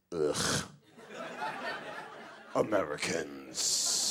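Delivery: low, slow disdain; groan, then draw it out with contempt; disgusted British man. Retold or imitated. British man disgusted by loud Americans.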